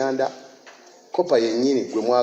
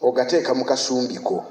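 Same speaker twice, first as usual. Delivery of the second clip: prayer; 3 semitones lower than usual. Real, not acted. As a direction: lead the church in earnest prayer. Leads closing prayer.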